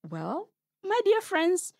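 plain reading voice

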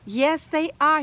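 plain reading voice

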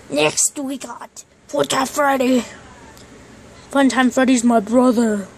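usual speaking voice

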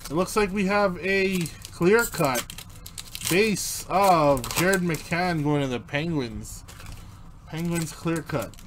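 ordinary delivery

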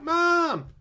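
high, loud scared yell for mom; panicked child's cry. Retold or imitated. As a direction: scream for mom in terror. Kids freak out, call mom.

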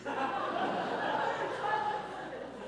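breathy, soft laugh; real laugh. Real, not acted. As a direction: laugh at the silly drawing.

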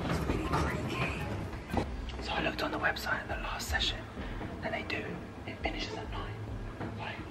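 hushed, nervous whisper; nervous night vlog whisper. Real, not acted. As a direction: whisper it, afraid of being caught. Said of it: "Right, you're whispering".

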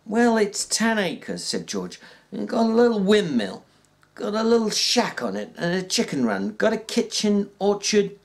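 a lightly voiced ranch hand, plain and steady; George, a working man. George telling Lenny about the farm, lightly voiced.

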